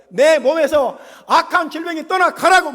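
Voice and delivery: loud, high-pitched order to depart; commanding proclamation. Shown as a demonstration. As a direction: drive the sickness out with a preacher's shout. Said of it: "막 선포해야". (크게 꾸짖어 쫓아내듯)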